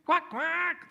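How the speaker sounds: high-pitched quack; duck quacking. Retold or imitated. Duck answering mother's offer of bread, high quacking. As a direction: imitate an eager duck.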